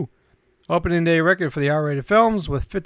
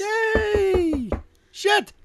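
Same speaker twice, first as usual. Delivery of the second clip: high, hammy mock cheer. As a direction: cheer it up high, tongue in cheek.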